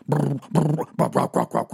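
imitating a metal band's guitar and chant; comic imitation of metal band noise; mock heavy metal. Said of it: "be going".

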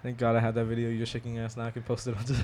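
ordinary delivery

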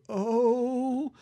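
put-on slow, halting old man voice. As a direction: drag out the words like a frail old man. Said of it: "this old guy who can barely deliver his lines".

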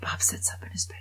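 hushed grave whisper; hushed storytelling. Real, not acted. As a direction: drop to a whisper for the sad part. Dream scene told hushed.

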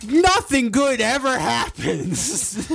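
high, slow wail of mock despair; hammed-up slow high moan; imitating exasperated dad. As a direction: lament it like a fed-up sports-fan dad, drawn out. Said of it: "my dad started getting into".

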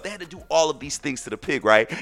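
ordinary talk, nothing marked

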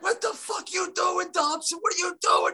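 high, mock angry yelling at the actor; imitating Frank's outburst. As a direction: retell Frank losing it mid-take, pitched high. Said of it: "Frank is like".

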